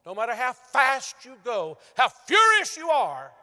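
rising shout on furious, slowed right down; preaching climax. Real, not acted. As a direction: hammer it home at the top of the voice.

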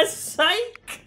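high, loud laughing "Psych!"; laughing taunt. Real, not acted. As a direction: crow it through a laugh. Teases the teammate over the banned word.

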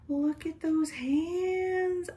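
high, slow cooing. Real, not acted. Cooing over reborn baby doll.

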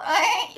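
high mock bleating cry; goat-like bleat. Shown as a demonstration. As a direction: imitate a cat bleating like a goat. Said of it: "그건 염소죠".

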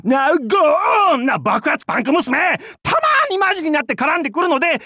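high-pitched comic complaint; theatrical griping. Acted. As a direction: rattle off an exaggerated grumble. Comic complaint about a troublesome girl.

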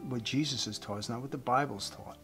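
ordinary delivery

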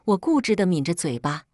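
usual speaking voice

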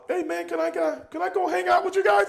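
high, hopeful mock plea; pleading kid. Matt Damon asking the wrestlers to come, high pleading voice. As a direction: ask like an eager kid.

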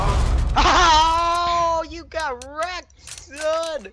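high, drawn-out gloat; taunting. Real, not acted. Player gloating at a beaten friend, taunting. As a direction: crow it high and slow. Gloating over a game kill.

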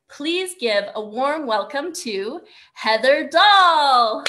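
loud, slow, drawn-out introduction; stretched, loud host welcome; big announcer welcome. Real, not acted. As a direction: bring the speaker on like an emcee. Host introduces the next speaker.

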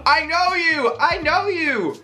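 high, rising excited shouts; excited recognition. Real, not acted. Streamer spotting a viewer she knows, excited shouts. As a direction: burst out, thrilled to recognize her.

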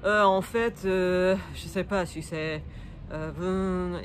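drawn-out fillers with trailing pauses; hesitant French searching. Shown as a demonstration. Learner pausing so the French listener jumps in, hesitant searching. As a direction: demonstrate stalling mid-sentence for help. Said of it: "where I pause and then kind of ask for help".

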